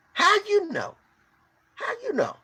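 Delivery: pleading how you know. Real, not acted. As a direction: ask it hushed and high. Repeating the challenge more softly.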